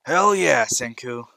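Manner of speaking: pumped-up shout to a friend; excited cheer. Acted. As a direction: whoop it out eagerly, loudest on the opening words. Excited character cheers in reply.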